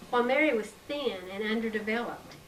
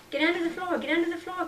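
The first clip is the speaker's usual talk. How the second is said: urgent order, pitched higher; higher-pitched command to hide; 8 semitones higher, faster than usual. A narrator's reading. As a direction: bark it at her little sister. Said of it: "commanded Laura".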